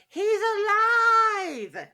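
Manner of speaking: high, loud, slow mock Frankenstein proclamation; mad scientist cry. Cohost redoes the Frankenstein line, hammed up and loud. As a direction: drag it out like a mad scientist's triumph. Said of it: "I said".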